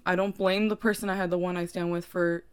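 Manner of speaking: ordinary talk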